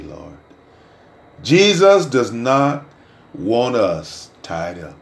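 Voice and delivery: ordinary talk, nothing marked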